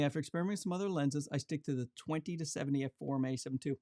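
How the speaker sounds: normal talking voice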